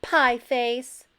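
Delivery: loud insult hurled over the wall; angry insult. A narrator's reading. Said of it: "name-calling shouted".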